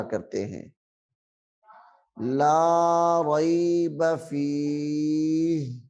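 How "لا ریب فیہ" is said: slow drawn-out Quranic recitation. Shown as a demonstration. Teacher reciting slowly for students. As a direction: chant it slowly like tajweed. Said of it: "Quran teacher reciting example".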